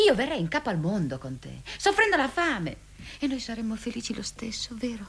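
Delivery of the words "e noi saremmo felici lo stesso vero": whispering while hiding. Acted. Tender lovers' whisper.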